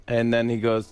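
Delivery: ordinary talk, nothing marked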